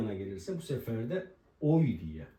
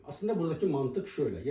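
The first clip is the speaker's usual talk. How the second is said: normal talking voice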